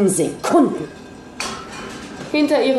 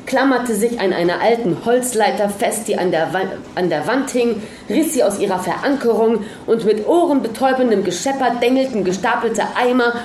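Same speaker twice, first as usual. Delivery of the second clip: plain reading voice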